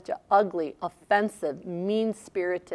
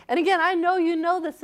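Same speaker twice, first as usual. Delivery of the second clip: usual speaking voice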